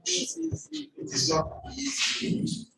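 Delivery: slow, drawn-out mock solemn delivery, the last words pitched high; slow hammed-up speech; mock pompous speaker. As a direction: stretch it out like a nervous orator.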